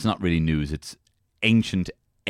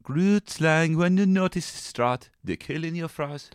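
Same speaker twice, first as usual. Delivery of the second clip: high, drawn-out mock South African accent; 7 semitones higher, slower than usual. Co-host mimicking the creature's name, mock South African accent.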